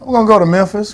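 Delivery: excited. Real, not acted. Answering the hype, calling out.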